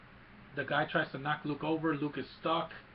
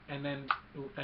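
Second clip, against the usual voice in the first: ordinary talk, nothing marked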